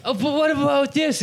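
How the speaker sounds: very high mock-eager voice; mock eager pleading. Actor offering a bad accent at audition, mock eager. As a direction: pitch it way up, self-mocking. Mocking himself attempting an accent.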